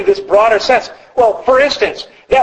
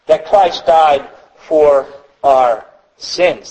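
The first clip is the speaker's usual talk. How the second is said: solemn scripture reading; 6 semitones lower, much slower than usual. Acted. Quoting scripture, slowed down.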